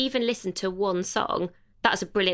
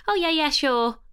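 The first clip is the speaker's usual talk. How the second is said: flippant; 7 semitones higher, 3 dB louder than usual. Retold or imitated. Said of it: "in quite a sort of flippant way".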